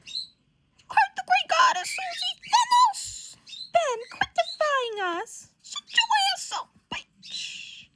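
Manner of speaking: very high, slow theatrical screech; imperious scolding. Acted. Self-styled great goddess berating Susie and Ben, high imperious screech. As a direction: screech the orders like a hammy villain. Acted villain berating the heroes.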